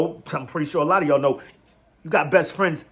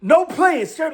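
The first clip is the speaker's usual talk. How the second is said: higher, louder, emphatic draft-day declaration; intense player's vow; 4 semitones higher, 5 dB louder, slower than usual. Retold or imitated. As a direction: act out a fired-up player addressing the crowd. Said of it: "I can't express it the way he did".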